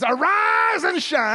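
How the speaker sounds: high, loud, long-held ARISE; exultant proclamation. Real, not acted. Preacher reading Isaiah sixty, exultant shout. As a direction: shout the verse out, stretching it joyfully.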